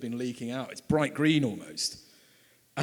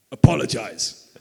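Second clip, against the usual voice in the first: loud, curt imitated command; mock stern order; 3 semitones lower, 10 dB louder, slower than usual. Seven-foot guard ordering the traveller to apologise, loud mock command. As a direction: bark the one word like a towering guard.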